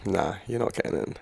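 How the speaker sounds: calm bouncer brush-off; bouncer's flat refusal. Retold or imitated. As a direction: quietly turn him away at the door. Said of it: "the bouncer just looks at Luke and is like".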